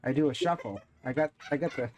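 showing off. Real, not acted. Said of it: "Look what I can do".

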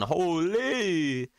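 high, stretched-out exclamation; Grady. Retold or imitated. Streamer showing off his catchphrase mimicry, high drawn-out impression. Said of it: "I'm not talking like Grady".

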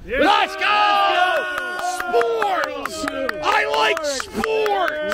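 hyped fan bellowing about sports; yelling. Pretend. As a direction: yell it like a crazed fan in the stands. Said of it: "And I yell!".

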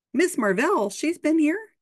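high-pitched astonished question; acted surprise. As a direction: voice leaps up in startled disbelief. Startled visitor's reply in story.

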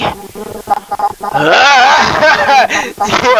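mock old lady cackle; granny cackle. Streamer as Granny cackling at her grandson, mock cackle.

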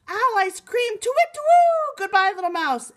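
high, loud hooting alarm; panicked owl. Acted. Owl flees the mouse after hearing the Gruffalo's food, panicked. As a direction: cry out in fright and hoot away.

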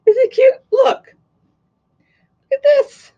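high, loud, delighted squeal; excited high squeal. Pretend. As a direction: squeal it with glee. Showing off new hat playfully.

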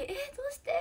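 high-pitched acted "what, why?" reaction; retold surprise. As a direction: replay your astonishment with a big jump in pitch. Retells reaction to unexpected phone call.

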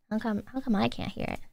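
normal talking voice

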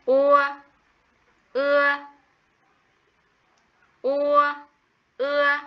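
ua, ưa called out high and loud for the class to repeat; clear model reading. Shown as a demonstration. Teacher models the rhymes ua, ưa for the class, loud and clear. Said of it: "Vần ua và vần ưa chính là tên của bài học".

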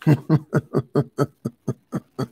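hearty character laugh; stork's laugh. Acted. Stork laughing at the other animals' question, hearty laugh. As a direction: let a big laugh roll out. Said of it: "Laughed Stork".

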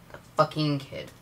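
normal talking voice

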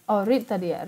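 normal talking voice

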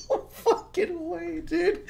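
high, laughing, slow No fucking way; cracking up in disbelief. Real, not acted. Watching the skit and cracking up, high and laughing. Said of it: "And I was like".

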